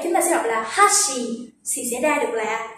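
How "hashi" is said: "hashi" starting high, falling on shi; high-to-low pitch accent. Shown as a demonstration. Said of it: "Và khi ngược lại".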